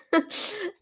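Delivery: a short breathy unvoiced giggle; whispered giggle. Soldier sniggering at the joke, whispered giggle. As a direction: snicker under the breath.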